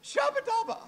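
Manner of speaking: a sudden high squeal of shabba dabba; high-pitched mock tongues. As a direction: pitch it way up like an excited babble. Retelling speaking in tongues.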